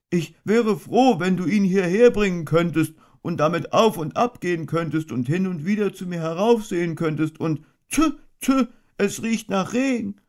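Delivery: a little bear anxiously asking, then shrugging; worried Pooh. A narrator's reading. Pooh asks for umbrella.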